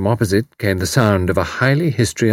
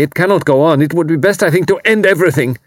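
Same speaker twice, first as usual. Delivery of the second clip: louder, higher, rising despair; bitter outburst; 9 semitones higher, 5 dB louder, faster than usual. A narrator's reading. Refugee to Tuppence, bitter rising despair. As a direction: let the bitterness break out. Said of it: "Karl went on bitterly".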